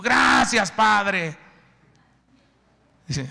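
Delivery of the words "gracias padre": much higher, louder shout of thanks. Acted. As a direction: belt out the gratitude. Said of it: "Y tú". (gritando gracias a todo pulmón)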